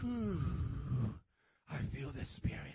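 low, hushed, put-on awe; mock spiritual rapture. Preacher mimics churchgoers coming in, low mock rapture. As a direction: play a swooning worshipper, voice dropped way down.

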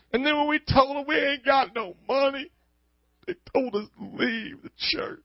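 mocking Southern sob story. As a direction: whine it out in an overdone Southern accent. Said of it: "you put a Southern accent to what you're saying".

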